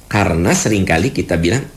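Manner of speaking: ordinary talk, nothing marked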